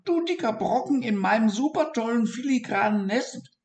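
very high, mocking refusal; high gloating hazel mouse; smirking mouse. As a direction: sneer at the idea. Mouse mocks the dormouse.

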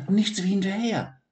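high, eager little voice; Siggi's thought. Acted. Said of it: "dachte Siggi".

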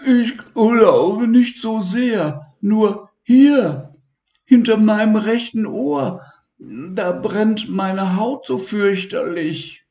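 high, slow and whimpering little creature; high, hurt little voice; Elmar's voice. Acted. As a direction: whine about the sore spot.

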